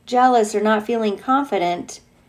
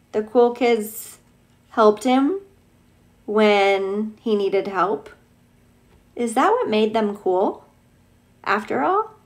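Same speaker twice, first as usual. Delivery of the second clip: usual speaking voice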